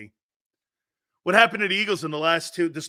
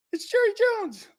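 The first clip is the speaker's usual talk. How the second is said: much higher, fast squeaky puppet voice; ventriloquist puppet voice. Retold or imitated. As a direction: play Romo as a dummy on Jerry's lap. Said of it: "you talking or Jerry?".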